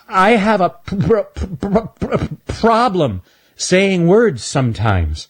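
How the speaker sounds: high voice stuck on pr, slow; Stanley explains his stutter, stammering; stammering squirrel. Acted. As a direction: catch on the pr and push through slowly. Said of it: "Why do you talk like that".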